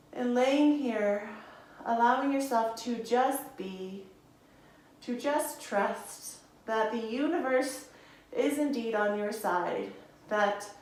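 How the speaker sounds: slow, soothing instructor cues timed to the breath; guided yoga voice. Acted. Yoga teacher guiding students through poses, soothing. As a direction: talk the class through each breath calmly.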